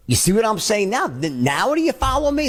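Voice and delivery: confident, insistent check-in; peak certainty. Shown as a demonstration. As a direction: lift the pitch and drive them to agree. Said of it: "my tone is".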